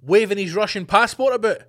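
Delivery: usual speaking voice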